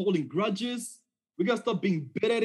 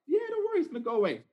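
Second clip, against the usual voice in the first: much higher, light and dismissive reassurance; breezy doctor; 9 semitones higher, much faster than usual. Retold or imitated. Sister doctor shrugging off his fear, breezy and much higher. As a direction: brush the worry off like a casual doctor. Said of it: "She's like".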